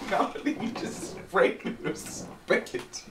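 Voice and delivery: slow, high words through laughter; giggling, breathy complaint; laughing. Real, not acted.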